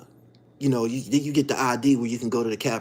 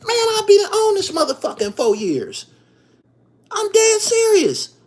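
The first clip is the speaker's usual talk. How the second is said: incredulous excitement; 6 dB louder than usual. Real, not acted. Scoffing at four years.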